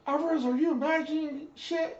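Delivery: barked shout, way up high and loud; high, loud drill instructor shout; drill instructor yell. Retold or imitated.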